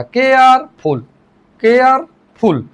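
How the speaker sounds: केयरफुल said slowly as केयर, pause, फुल; split into syllables. Shown as a demonstration. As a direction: stretch केयर, then set फुल apart. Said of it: "जब आपस में जोड़ते हैं". (केयरफुल को तोड़कर धीरे बोलें: केयर... फुल)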